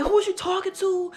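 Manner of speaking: retold yelling back. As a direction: pitch it way up, furious and defiant. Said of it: "I started getting real loud".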